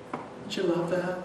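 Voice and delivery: normal talking voice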